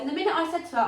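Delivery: ordinary talk, nothing marked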